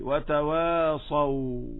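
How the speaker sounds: slow وتواصوا, last waw held and fading out; unbroken final waw. Shown as a demonstration. Teacher as if told to stop by examiner, unbroken final waw. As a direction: stop on وتواصوا without cutting the sound. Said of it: "لا ينقطع صوتي".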